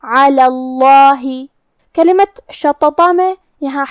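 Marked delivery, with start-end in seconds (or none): 0.0-1.2 slow علی اللہ with thick, dark double L; heavy laam. Shown as a demonstration. Teacher showing the heavy laam in علی اللہ, slow thick L. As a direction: recite اللہ with a heavy, drawn-out "Llaa". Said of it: "لفظ الجلالہ کو بھاری آواز میں پڑھیں گے".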